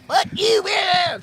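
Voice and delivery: high shouted fuck you; mock yell. Girl in the car yelling back. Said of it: "She was like".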